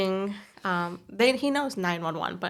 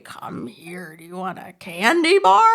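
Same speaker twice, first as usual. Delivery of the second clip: pretend old stranger; 9 dB louder, slower than usual. Mom testing her kids with candy, mock creepy old-person voice. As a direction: play an old stranger luring a child, ending in a rising holler. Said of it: "I pretend I'm this old person".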